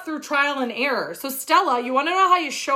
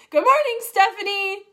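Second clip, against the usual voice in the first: cheery live-stream welcome; bright greeting; 8 semitones higher than usual. Real, not acted. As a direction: greet a viewer with a lift.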